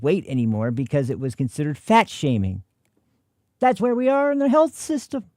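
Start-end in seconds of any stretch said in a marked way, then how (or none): 3.6-5.2 much higher mocking tone; high sarcastic voice. Real, not acted. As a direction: sneer it out in disbelief. Sarcastic remark then laugh.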